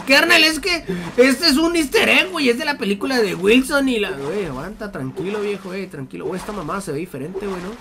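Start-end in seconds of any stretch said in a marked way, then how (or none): 0.0-4.1 mocking commenters. Gamer mocks commenters who claim an easter egg, laughing parody. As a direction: parody the know-it-all viewers with a laugh. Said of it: "Y vienen y me dicen".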